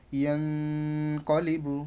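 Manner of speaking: ইয়াং held as a nasal hum, then কলিবু; ghunnah. Shown as a demonstration. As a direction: hold the ng in ইয়াং before কলিবু. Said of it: "গুণনা করে করতে হবে". (ইয়াং-এ গুন্নাহ, তারপর কলিবু)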